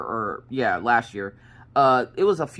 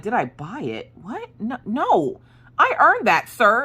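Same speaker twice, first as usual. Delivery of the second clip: mock indignation; 8 semitones higher, slower than usual. Mom answering son's doubt about her check mark, mock indignant. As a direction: act playfully offended, pitch leaping up.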